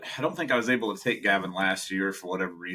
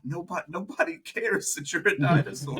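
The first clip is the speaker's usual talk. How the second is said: exasperated parent; 8 semitones higher than usual. Retold or imitated. Parent scolding child.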